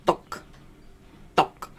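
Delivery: duk made at the back of the tongue; throaty ferret duk. Shown as a demonstration. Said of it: "Pronounce the duk with the back of your tongue".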